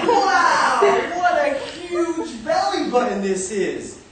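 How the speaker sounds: high, loud and slow, full of wonder; a tiny boy marveling at a huge belly button; amazed little boy. Acted. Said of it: "was like".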